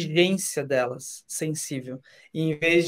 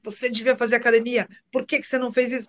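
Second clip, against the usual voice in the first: higher, nagging partner voice; mock scolding Isabela; 4 semitones higher than usual. Guest imitating his partner scolding him, higher pitched. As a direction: imitate her fussing at you. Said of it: "a Isabela briga comigo".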